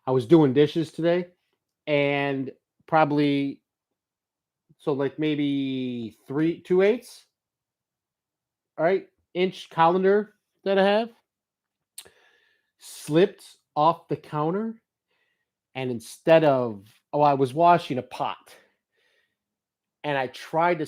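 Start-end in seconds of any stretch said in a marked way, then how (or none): none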